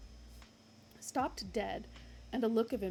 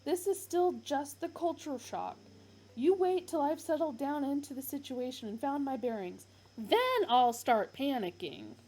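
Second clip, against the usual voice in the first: higher, louder indignant insistence; louder, higher huffy Arthur; Arthur's voice; 5 semitones higher, 7 dB louder than usual. Acted. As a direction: insist you are calm. Arthur insisting he's fine.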